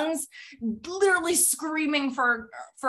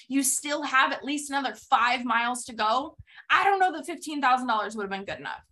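usual speaking voice

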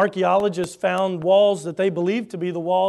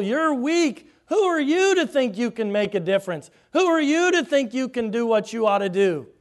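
high, loud jeering taunt; ridicule; 8 semitones higher, 7 dB louder than usual. Acted. Mocker ridiculing the workers, loud high jeering. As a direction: sneer down at them, loud and high. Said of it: "here comes the ridicule".

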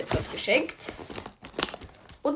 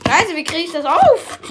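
higher and louder exasperated yell; frustrated shout; 8 semitones higher, 10 dB louder than usual. Real, not acted. Struggling to open the sauce. (genervter lauter Ausruf)

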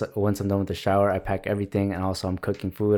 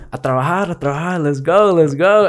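much higher and louder, rising rally shout; wake-up call; 8 semitones higher, 7 dB louder than usual. Retold or imitated. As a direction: holler to get the sleeping house working. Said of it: "be like".

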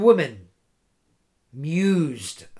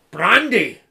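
snapped; 4 semitones higher, 6 dB louder than usual. Acted. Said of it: "snapped Caliver".

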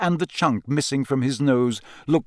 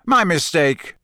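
usual speaking voice

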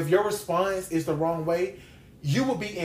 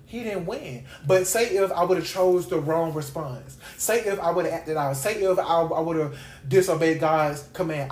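usual speaking voice

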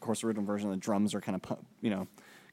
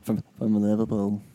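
mock Liverpool accent; 5 dB louder than usual. Podcast host joining the Beatles bit, hammed Liverpool accent. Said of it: "doing Liverpool accents".

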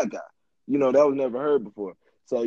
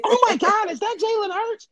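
mock fangirl shriek at the famous player; excited girl. Girl at the party spotting Jalen Hurts, high excited squeal. Girl spots the star quarterback.

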